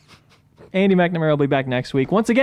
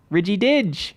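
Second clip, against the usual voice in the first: Australian accent; 4 semitones higher, slower than usual. Shown as a demonstration. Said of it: "Australian accent".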